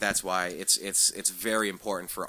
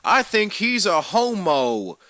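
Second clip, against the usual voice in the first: hammed-up Southern accent, higher and louder, slow drawl; stupid Southern accent; 8 semitones higher, 7 dB louder than usual. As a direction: play a dumb Southern bigot, mock Southern accent. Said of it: "dumbass Southern accent there".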